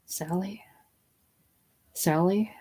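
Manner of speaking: hushed, tentative question; low whisper into the phone; strident whisper. Acted. Princess to Sally on the phone, strident whisper. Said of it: "in a low, strident whisper".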